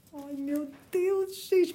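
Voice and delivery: slow, drawn-out swoon; swooning sigh. Real, not acted. Reader swooning over the romantic hero. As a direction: melt over the handsome man.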